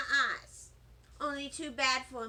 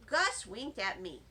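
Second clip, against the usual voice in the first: plain reading voice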